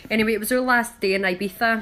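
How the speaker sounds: ordinary delivery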